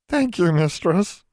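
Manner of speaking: high, slow elf voice; grateful house elf. A narrator's reading. Elf thanking Miss King, still tearful.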